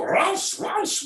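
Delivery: "raus" barked high in a put-on German accent; mock German barking. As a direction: bark "raus" like a cartoon German guard. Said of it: "we all grew up with the German accents".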